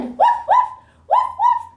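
high, loud playful woofs; yappy little dog; dog barks. Pretend. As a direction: bark like a puppy for kids.

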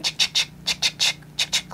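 whispered clicking sounds like a camera shutter; tongue clicks mimicking snapshots; camera click noises. Angels snapping photos, imitated with clicking camera noises.

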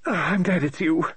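high lady novelist, sighing with relief. Acted. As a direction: breathe out the relief. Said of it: "gave a deep sigh and said".

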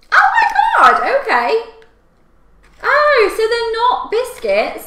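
high, loud, startled cry; shocked. Real, not acted. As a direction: caught off guard, exclaiming. Sweets spill everywhere.

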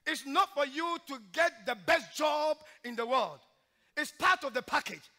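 loud, high preaching cry; preaching at full force. Acted. Preacher exhorting the church in a loud preaching cry. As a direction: drive each line home like a revival preacher.

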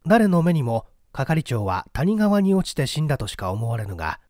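ordinary delivery

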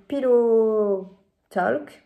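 slow, laboured English in a French accent; French-accented English, drawn out very slowly; bad English accent. Real, not acted. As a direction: stretch out the English words with a clumsy French accent. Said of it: "mon accent anglais est à chier".

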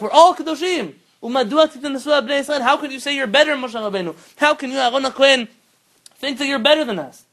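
high, loud protest as Korach; indignant challenge. Retold or imitated. Korach confronting Moshe and Aaron, high and loudly indignant. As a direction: challenge the leaders at full voice.

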